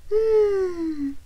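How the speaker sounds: put-on a little girl smelling pudding; Goldilocks savouring. A narrator's reading. Goldilocks sniffing the pudding, dreamy. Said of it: "said Goldilocks".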